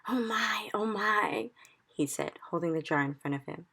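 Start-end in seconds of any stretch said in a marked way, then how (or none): 0.1-1.5 breathless awed whisper. Acted. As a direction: whisper it in wonder. Giant marvels at caught dream.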